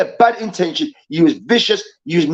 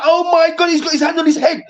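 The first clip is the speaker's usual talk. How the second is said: higher, alarmed retelling of his gasp; shocked Lawrence Taylor; 9 semitones higher than usual. Retold or imitated. Lawrence Taylor seeing the broken leg, pitched-up mock horror. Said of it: "Lawrence Taylor's like".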